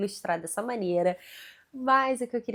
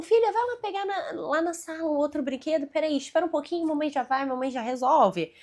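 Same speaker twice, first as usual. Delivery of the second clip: higher, soothing mom voice stalling kindly; gentle coaxing; 5 semitones higher, 4 dB louder than usual. Shown as a demonstration. Mother sweetly stalls her daughter who wants a toy, higher, soothing. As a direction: coax the child sweetly to wait a moment. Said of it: "tentar dar uma enrolada".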